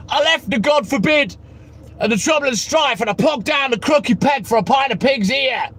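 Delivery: half-sung, shouted cockney rhyming slang. Acted. Streamer doing a cockney geezer for chat, sung cockney. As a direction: belt it out like a London pub singalong. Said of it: "You don't talk like that".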